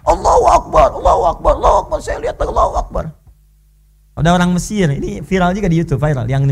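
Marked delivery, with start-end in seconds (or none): 0.0-3.0 high joyful cry of praise; shouting Allahu Akbar. Retold or imitated. Blind student cheering as his sight returns, high excited cry. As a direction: cry out in high ecstatic joy. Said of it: "Dia teriak".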